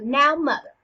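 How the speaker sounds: loud, punchy opening; mildly heated challenge; fired up. Acted. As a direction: come in fired up at your mother. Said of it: "Hamlet's pretty fired up".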